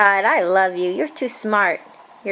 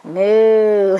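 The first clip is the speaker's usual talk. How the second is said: a light mock moo for the child; cow moo; 2 semitones lower, 4 dB louder than usual. Shown as a demonstration. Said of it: "What sound does a cow make".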